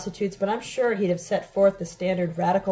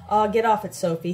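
usual speaking voice

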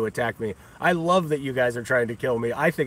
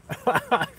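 high cackle; 9 semitones higher than usual. Real, not acted. Laughs after taunt.

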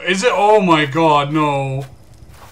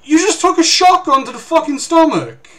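high, loud disbelieving shout; outraged that the shot did nothing; incredulous yell; 10 semitones higher, 6 dB louder than usual. Real, not acted. Gamer yelling at an enemy who survived a shotgun blast, incredulous.

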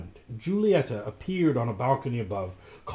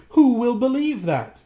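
anxious; 11 semitones higher, 7 dB louder than usual. Acted. Giulietta in despair.

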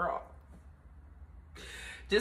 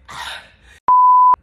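big loud sigh; 17 dB louder than usual. Real, not acted. Overwhelmed about leaving.

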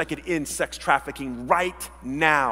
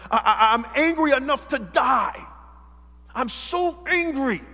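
high, stammering acted fury; angry outburst; 6 semitones higher than usual. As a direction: play Jonah angry, voice climbing. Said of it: "Jonah says to him".

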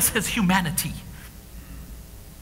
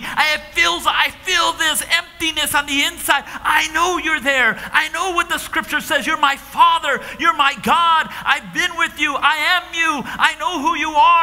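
anguished prayer; 4 semitones higher than usual. Acted. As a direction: plead with God in anguish. Jesus's anguished prayer to God.

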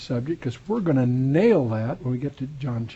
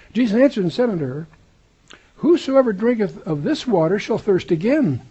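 raised, emphatic reading of scripture; 6 semitones higher, 4 dB louder than usual. Acted. Preacher reads the Bible aloud to his class, raised and emphatic. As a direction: proclaim the verse to the congregation.

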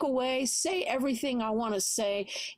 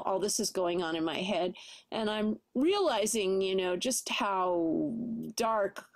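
ordinary talk

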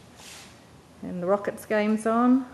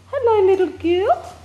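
much higher, louder sing-song greeting; high cooing hello to a little dog; pet voice; 11 semitones higher, 5 dB louder than usual. Real, not acted. Greeting her dog Posh.

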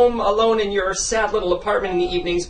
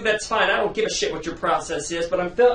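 ordinary delivery

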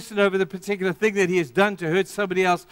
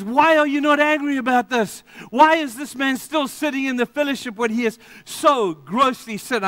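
Paul's angry rebuke; 7 semitones higher, 4 dB louder than usual. Retold or imitated. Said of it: "he gets really angry".

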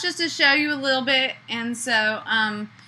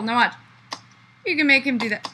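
usual speaking voice

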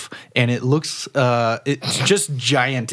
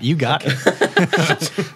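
slow laughing through words; 3 semitones higher, much slower than usual. Real, not acted.

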